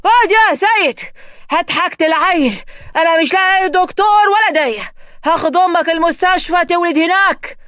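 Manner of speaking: loud, urgent commands; loud, breathless father; panicked father. Acted. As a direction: shout orders, out of breath from running.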